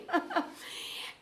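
high, short real laugh; breaking into a chuckle; amused laugh. Real, not acted. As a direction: laugh at a fond funny memory. Amused by funny student memory.

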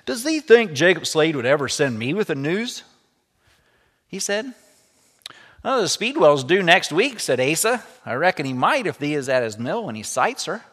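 lively read-aloud storytelling voice; story narration. A narrator's reading. Teacher reading a story to the class, lively read-aloud. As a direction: bring the story alive for the students.

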